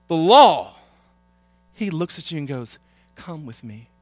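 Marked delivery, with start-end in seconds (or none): 0.1-0.8 loud, sudden pronouncement; mock condemnation. Acts out a condemning reply. 3.1-3.9 gentle invitation. Acted. Jesus's gentle invitation, acted.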